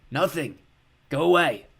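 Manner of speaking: cracked creature voice. Acted. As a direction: grumble it out like a sick old creature.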